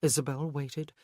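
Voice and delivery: plain reading voice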